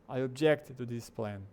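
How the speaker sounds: slow, with obJECT stressed on JECT; stress on last syllable. Shown as a demonstration. Teacher's sample sentence, "object" stressed on the second syllable. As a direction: lean on the end of "object". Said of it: "onde a ênfase tá no final".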